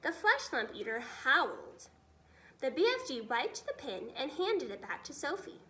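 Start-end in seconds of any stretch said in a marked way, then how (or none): none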